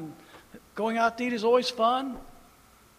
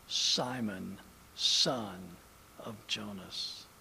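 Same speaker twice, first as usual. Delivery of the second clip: low, soft and slow; gentle Jesus naming Simon; soft address; 10 semitones lower, 7 dB quieter, much slower than usual. Acted. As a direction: say the name like a caress. Soft repetition of title.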